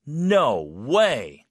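forceful "no way", both words hit hard; strongly shocked. Shown as a demonstration. Teacher hearing of a two-week romance wedding, shocked. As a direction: react in shock, punching each word. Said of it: "쇼킹한 상황에서는 좀 더 강하게".

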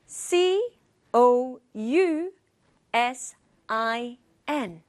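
C O U S I N spelled again, letter by letter, slowing on S I N; over enunciating. Shown as a demonstration. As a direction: spell C O U S I N deliberately. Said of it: "Once more".

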